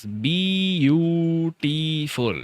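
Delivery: "beautiful" very slowly, each of four syllables held long. Shown as a demonstration. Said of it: "it's four syllables". ("beautiful" का हर syllable बहुत धीरे, लंबा खींचकर)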